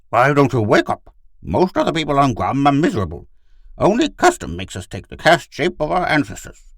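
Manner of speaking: high, loud, earnest pleading; sad appeal. A narrator's reading. Gur urges Pid to wake up, sad. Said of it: "Gur said sadly".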